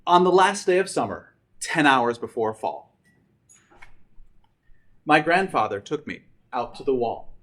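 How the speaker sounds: lively rhyming read-aloud to students; storybook reading. A narrator's reading. Teacher reading Dr. Seuss to seniors, lively and rhythmic. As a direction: read it with bounce, like story time.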